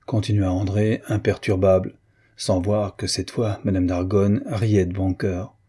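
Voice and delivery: ordinary delivery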